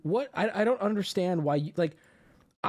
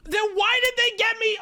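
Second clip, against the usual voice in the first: high-pitched, incredulous objection; indignant protest; 3 dB louder than usual. Real, not acted. Answering a viewer who says no big deal, indignant. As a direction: throw it back way up high.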